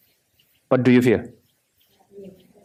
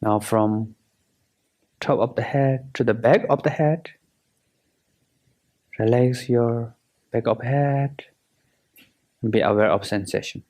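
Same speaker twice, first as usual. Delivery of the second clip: guided meditation. Acted. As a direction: guide them through relaxing, unhurried and measured.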